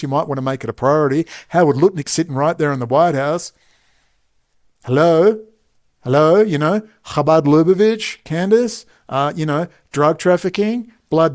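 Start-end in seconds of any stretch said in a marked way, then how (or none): 4.8-6.4 louder mock call, rising. Real, not acted. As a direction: call out to get her attention. Calling out to Candace.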